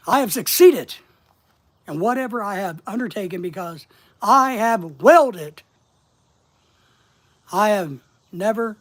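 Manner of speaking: "succeeded" and "willed" punched loud, with pauses between phrases; deliberate quotation reading, hammer "succeeded", "willed", "never"; striking key words hard. Shown as a demonstration. Reading a Napoleon quote as an exercise, key words like "succeeded" struck hard. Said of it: "striking the words in big type hard".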